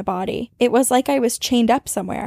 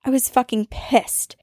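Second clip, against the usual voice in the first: usual speaking voice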